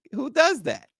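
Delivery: high-pitched disbelief; incredulous. Real, not acted. Host teasing a friend over a risky Walmart trip, incredulous. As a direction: pitch leaps way up in incredulous protest.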